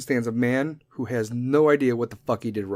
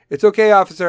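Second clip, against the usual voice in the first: loud, higher-pitched protest; calling to an officer; 7 semitones higher, 10 dB louder, faster than usual. Acted. Excusing a friend to the arresting officer, loud call. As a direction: call it out to wave the police off.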